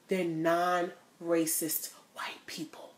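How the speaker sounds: hushed conspiratorial whisper; whispered punchline. Acted.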